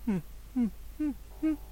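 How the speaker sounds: a ghostly little sniggering right by his ear; eerie nasal chuckle. Retold or imitated. The woman snickers softly into his ear, eerie snickering. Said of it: "みたいな笑い声っていうか、鼻で笑う".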